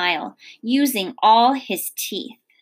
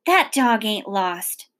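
ordinary delivery

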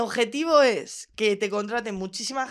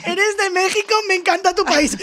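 high, mocking fan voice raised high and a bit louder; fan exagerada; 10 semitones higher, 3 dB louder than usual. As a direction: imita a la fan con burla aguda. (imitando burlona a una fan aguda)

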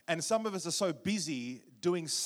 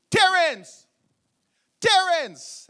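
loud, high-pitched yell for his son; imitating a Jamaican dad's booming summons; father's yell; 13 semitones higher, 14 dB louder, much slower than usual. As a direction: holler the name from upstairs. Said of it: "he'd yell at me".

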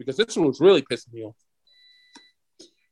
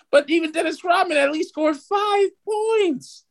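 exasperated high pitch; 12 semitones higher, slower than usual. Real, not acted. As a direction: voice climbing into a drawn-out, high-pitched protest. Exasperated rant about Simmons not scoring.

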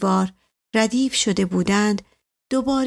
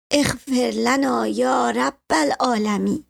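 slow, higher-pitched invocation; intoned prayer; 4 semitones higher than usual. A narrator's reading. Old woman prays along the village alley, slow raised-pitch intoning. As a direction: draw out the Arabic plea for mercy.

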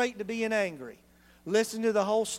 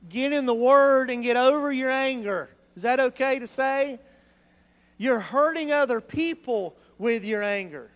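exhortation; 5 semitones higher, 6 dB louder than usual. Real, not acted. Preacher exhorting.

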